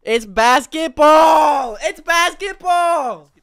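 high, drawn-out screams of excitement; real ecstatic shout, way up high; excited shouting. Real, not acted. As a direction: yell it out, overjoyed, stretching the words. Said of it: "I'm just so excited".

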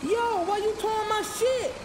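yelled at the tow truck driver; yelling. Acted. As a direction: call out the tow man, outraged. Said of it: "he yelled at the tow truck driver".

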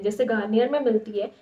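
ordinary delivery

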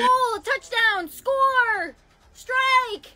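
excited cheering. Acted. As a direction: shout like a fan at a game. Cheering sports words in charades.

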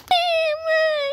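high tearful whine; acted crying. Hurt boy answers his mother, crying. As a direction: whimper to mother. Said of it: "filho, não chora".

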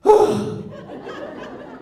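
loud, sharp breathy gasp; alarmed gasp. Retold or imitated. Agent learning the call is taped, mock gasp. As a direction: suck in air in mock shock. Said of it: "And [name] goes".

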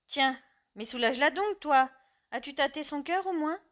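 loud, high, insistent push; father orders son to help his mother, loud and urgent; urgent demand. Acted.

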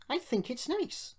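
high-pitched little engine; gentle Peter Sam. A narrator's reading. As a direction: disagree mildly in a high voice. Peter Sam timidly disagrees.

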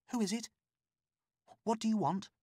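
a nervous woman calling through a door; frightened captive woman. A narrator's reading. Woman locked behind door.

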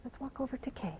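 ordinary delivery

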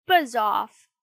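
loud dismissive yell; annoyed puppet's shout; crying out. A narrator's reading. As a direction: shoo a pest away loudly. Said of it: "cried Pinocchio".

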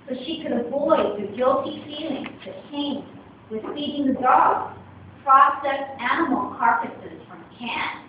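plain reading voice

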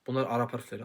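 ordinary talk, nothing marked

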